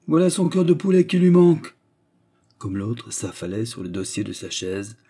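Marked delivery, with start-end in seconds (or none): 0.1-1.8 high, loud, scornful cry; mocking exclamation. Manager seeing the suitor collapse, mocking. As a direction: exclaim scornfully at his weakness.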